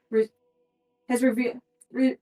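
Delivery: ordinary talk, nothing marked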